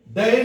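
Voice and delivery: डे said as an open 'dae'; wrong pronunciation. Shown as a demonstration. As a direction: widen the vowel of डे to 'ae'. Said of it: "डे नहीं कहते हैं".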